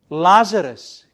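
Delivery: a loud ringing name, called into the tomb; commanding call. Acted. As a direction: summon him by name, full voice. Repeats Jesus' call.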